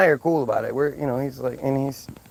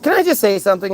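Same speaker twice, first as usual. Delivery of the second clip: higher, louder mock demand; mimicked interrupting friend; 5 semitones higher, 5 dB louder, faster than usual. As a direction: imitate her butting in insistently. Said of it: "she goes".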